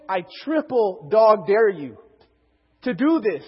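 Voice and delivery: loud, slow, drawn-out challenge; emphatic dare. Retold or imitated. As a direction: throw down the ultimate dare, word by word. Acting out the ultimate dare.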